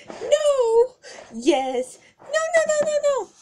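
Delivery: very high, loud squeaky no's; squealing refusal. Acted. Pony refusing her captor in a high squeal. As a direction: squeal no over and over. Yes-no bickering game.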